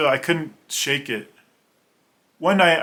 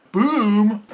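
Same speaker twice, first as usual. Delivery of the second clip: loud boom sound effect; 8 semitones higher, 5 dB louder than usual. Retold or imitated. Kicking in the cabin door.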